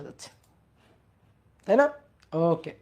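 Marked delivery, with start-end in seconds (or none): none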